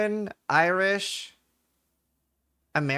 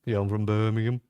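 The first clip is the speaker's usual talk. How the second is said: put-on deep Brummie accent; 6 semitones lower than usual. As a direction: lower Birmingham accent, rising at the end.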